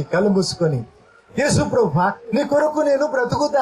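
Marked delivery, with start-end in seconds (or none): 1.4-3.6 a heartfelt sample prayer, rising at the end; demo prayer. Shown as a demonstration. Preacher models the vow for the congregation, earnest prayer. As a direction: show them how to pray it from the heart. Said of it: "నీకు ఇష్టమైతే మనసారా పలుకు".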